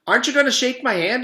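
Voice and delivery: put-on high, loud, fast child voice; eager kid. John pressing Louis for a handshake, high and fast.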